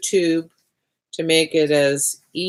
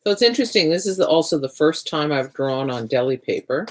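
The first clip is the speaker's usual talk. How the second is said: ordinary talk, nothing marked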